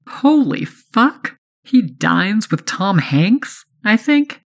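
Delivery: high, slow, amazed squeal; thrilled and starstruck; starstruck excitement. A narrator's reading. Inner excited thought.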